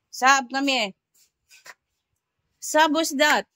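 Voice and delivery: loud, high call of the name. Real, not acted. Mother calling Sabrina to come, loud high call. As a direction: call Sabrina from across the house. Calling her daughter over.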